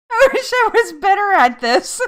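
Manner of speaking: high, loud lament through laughter; half-crying with laughter; laughing wail. Real, not acted. Vlogger embarrassed by their popular video, laughing wail.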